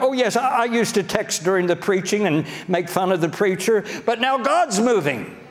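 mock convert, pitched well up; high-pitched parody of a newly won-over critic; critics jumping on board. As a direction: imitate a critic eagerly switching sides. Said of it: "They'll say something like".

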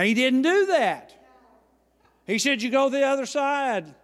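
loud, driving preacher voice; loud preaching to the congregation. Acted. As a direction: push the story out to the whole church at full force. Preacher retells the story forcefully.

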